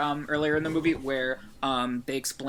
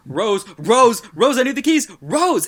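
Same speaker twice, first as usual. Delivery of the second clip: high, loud mock-frantic shouting; retold screaming; 9 semitones higher, 11 dB louder than usual. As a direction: shout her name in acted, mounting desperation. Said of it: "And screams at her".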